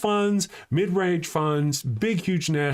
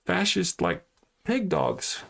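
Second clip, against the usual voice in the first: usual speaking voice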